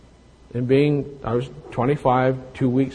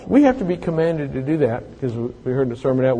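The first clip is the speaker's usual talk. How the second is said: usual speaking voice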